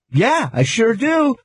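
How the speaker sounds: drawn-out awkward yes; slow, high sheepish reply. Retold or imitated. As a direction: stretch it out nervously. Retold answer to cop.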